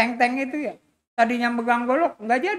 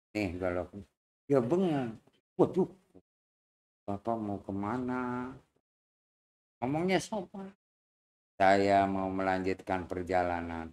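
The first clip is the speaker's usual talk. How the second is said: put-on calm old man; 13 semitones lower, 7 dB quieter than usual. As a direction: speak down low like a patient elder. Old man calm, polite reply. (suara kakek tenang, rendah dan pelan)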